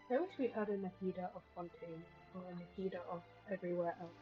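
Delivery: ordinary talk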